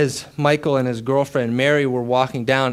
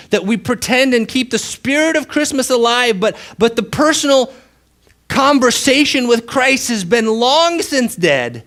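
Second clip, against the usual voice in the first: higher, louder preaching; impassioned preaching; 9 semitones higher, 6 dB louder than usual. Acted. Preacher urging the congregation, impassioned. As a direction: press the point home.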